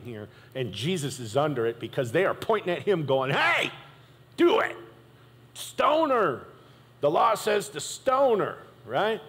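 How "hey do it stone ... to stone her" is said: mob shouting. Retold or imitated. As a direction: egg on the stoning crowd. Crowd pressing Jesus to stone her.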